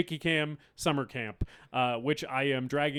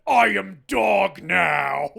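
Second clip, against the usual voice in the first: roared, growling metal-singer shout; Ray Gun Bush impression; 5 semitones higher, 13 dB louder, much slower than usual. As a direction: bellow it like a sludge metal frontman. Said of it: "that was just my Ray Gun Bush impression".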